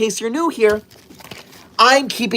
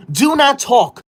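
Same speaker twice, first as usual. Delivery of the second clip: sharp, higher, louder command; stern order; 6 semitones higher, 4 dB louder, slower than usual. Acted. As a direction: snap it like a strict teacher. Scolding viewer over comment.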